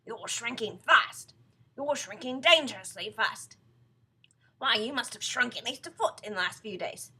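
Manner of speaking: loud, emphatic warning; alarmist Mr Twit. Acted. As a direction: scare her with each phrase. Voices Mr Twit alarming his wife.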